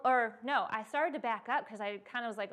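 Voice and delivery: ordinary talk, nothing marked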